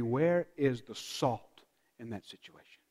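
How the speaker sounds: soft, low asking; hushed rhetorical question; quiet musing question. Shown as a demonstration. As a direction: ponder it under your breath. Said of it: "Our first reaction should be".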